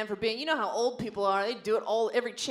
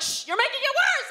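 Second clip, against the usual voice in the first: high, laughing hush; a mother shushing her daughter; mom hushing; 5 dB louder than usual. Retold or imitated. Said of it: "my mom was like".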